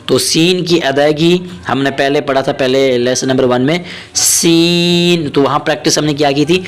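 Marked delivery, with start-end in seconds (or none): none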